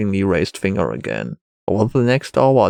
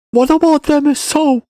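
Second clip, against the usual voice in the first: put-on high, louder boy's outburst; child's shout; 5 dB louder than usual. A narrator's reading. Boy challenges the Wanderer, high raised child voice. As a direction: call it out as an indignant boy. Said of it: "shouted in a louder voice".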